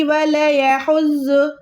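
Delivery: वला यहुज़्ज़ु with "la" clipped short, no stretch; unstretched वला यहुज़्ज़ु; mistake, short laa. Retold or imitated. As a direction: imitate the error, running "wala-ya" together in वला यहुज़्ज़ु. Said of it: "जल्दी जल्दी में लोग ऐसे ही".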